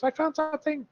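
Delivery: high-pitched mock impression of a companion; exaggerated high imitation voice. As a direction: squeak it out, imitating her discovery. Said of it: "I don't sound like that".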